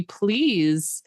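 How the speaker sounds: ordinary talk, nothing marked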